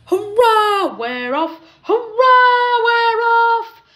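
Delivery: loud, high jubilant whoop; exultant storybook hurrah; happy cheer. A narrator's reading. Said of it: "puffed Thomas happily".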